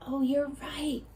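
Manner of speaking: ordinary delivery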